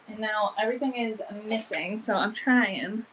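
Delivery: normal talking voice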